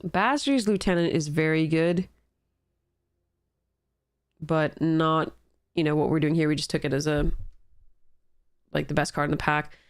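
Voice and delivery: ordinary delivery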